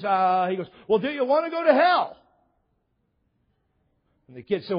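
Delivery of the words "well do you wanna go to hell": high, loud, exasperated mock challenge; hammed-up hard sell, punched at the end; pushy evangelist. Recruiter retold pressing the kid.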